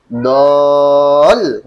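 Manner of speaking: DOL held long and loud, pitch flicked up at the end; intonazione rialzata. Shown as a demonstration. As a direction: stretch DOL, then kick the tone up sharply. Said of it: "nggak perlu digituin".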